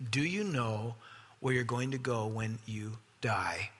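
low, slow and hushed; soft, weighty question to the congregation; grave question. Real, not acted. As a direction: drop to a solemn near-whisper, drawing out each word. Poses the sermon's grave question.

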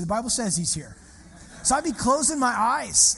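ordinary delivery

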